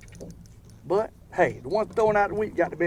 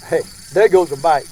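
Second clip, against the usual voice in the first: louder, excited shout; excited call-out; 7 dB louder than usual. Real, not acted. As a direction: call out the bite, thrilled. Calls out the bite.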